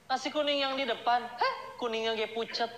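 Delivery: high, sharp nagging questions; fussy wife. Retold or imitated. Wife grills her husband about breakfast, high and nagging. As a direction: needle the husband about the food.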